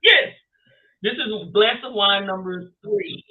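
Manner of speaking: tipsy, bubbly yes. Real, not acted. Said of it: "I'm a little tipsy".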